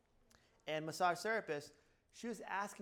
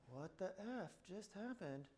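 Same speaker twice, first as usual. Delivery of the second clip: slow, softer, stunned mutter; stunned disbelief; 8 dB quieter, slower than usual. Retold or imitated. Owner after staff no-show, slow stunned disbelief. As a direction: let it fall out of you, dumbfounded. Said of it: "I'm like".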